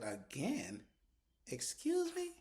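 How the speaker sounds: flabbergasted, high and sharp; shocked disbelief. Retold or imitated. Host reacting to Karen's again, high offended disbelief. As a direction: Excuse me? shoots way up, offended.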